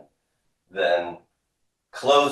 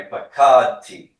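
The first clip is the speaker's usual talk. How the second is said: slow, loud, raised pitch, falling at the end; repeat-after-me model; 4 semitones higher, 9 dB louder, slower than usual. Shown as a demonstration. Teacher leading a class drill, slow loud model word. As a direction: sound it out for everybody to echo. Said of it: "Repeat after me".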